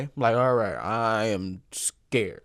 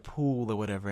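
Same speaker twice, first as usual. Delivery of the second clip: ordinary talk, nothing marked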